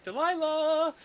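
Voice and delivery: high calling barmaid voice. Acted. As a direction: call across the tavern sweetly. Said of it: "she calls over to a girl".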